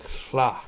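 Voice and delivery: "hla" with a breathy voiceless l; barred L. Shown as a demonstration. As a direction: blow air past the tongue for "hla". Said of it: "T H L".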